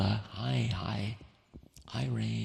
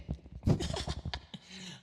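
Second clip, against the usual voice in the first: high-pitched giggle; 11 semitones higher than usual. Real, not acted. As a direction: burst into a long, high giggle. Laughs about the sloshy ball pit.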